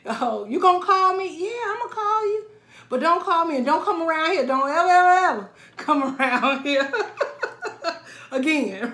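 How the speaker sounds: loud, high, sassy warning that breaks into laughter; sassy telling-off. Retold or imitated. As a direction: warn him never to come back, full sass. Acted exchange with ex, telling off.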